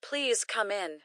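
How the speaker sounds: normal talking voice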